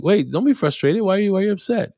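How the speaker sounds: high, gentle coaxing to a frustrated student; teacher coaxing an upset volunteer, high and gentle; soothing coax. Retold or imitated. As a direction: rise high and soften to calm them.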